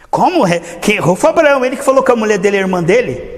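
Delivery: high, loud outraged objection; indignant protest. Acted. Pharaoh protests to God, loud and outraged. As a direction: protest the unfairness loudly.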